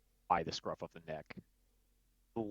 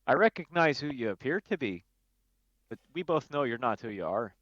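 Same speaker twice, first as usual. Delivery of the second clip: higher and louder, accusing; tense confrontation; 5 semitones higher, 5 dB louder than usual. Acted. Glamdry calling out the impostor, tense and accusing. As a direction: call his bluff.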